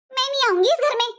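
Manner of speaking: sneering mimicry of the sister-in-law's old words; mocking quote. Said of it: "बोल कर गई थी तू".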